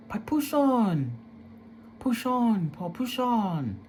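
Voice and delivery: high, slow urging push on; grandma's urging. Retold or imitated. Grandma telling crying grandson to endure, high and slow. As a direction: coax him gently like an old grandma. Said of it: "my grandma would be like".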